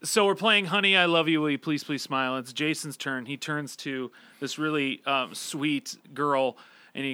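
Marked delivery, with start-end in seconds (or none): none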